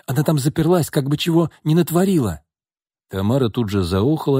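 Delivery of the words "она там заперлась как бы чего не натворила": higher, worried, urgent voice; acted alarm for a sister; exclaiming. A narrator's reading. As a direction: explain with anxious urgency. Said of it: "воскликнула Настя".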